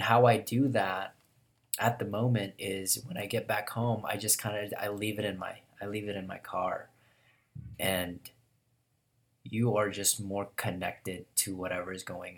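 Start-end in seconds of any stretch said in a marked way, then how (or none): none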